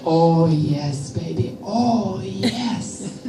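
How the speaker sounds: low, slow seductive purr; mock sultry. Breathing trick sounding sexy, low mock sultry voice. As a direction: play the accidental seducer.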